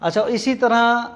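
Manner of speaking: ordinary talk, nothing marked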